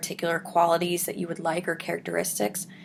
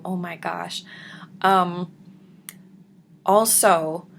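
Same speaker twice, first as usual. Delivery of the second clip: normal talking voice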